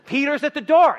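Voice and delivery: high, loud, laughing cry of news; excited announcing. Acted. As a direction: blurt out the news, giddy and loud. Servant girl announcing Peter.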